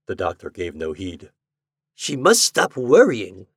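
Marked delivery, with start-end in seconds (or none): 1.9-3.5 slow, raised, louder exclamation; exclaiming. A narrator's reading. Doctor talking of his troubled patient, exclaiming. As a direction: exclaim it with slow, heavy emphasis. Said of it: "he exclaimed".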